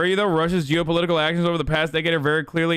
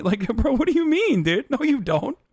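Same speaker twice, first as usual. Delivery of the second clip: ordinary talk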